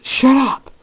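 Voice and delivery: loud, high-pitched outburst; angry shout. Acted. Jacob lunging at Michael, angry shout. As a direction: shout the taunts down.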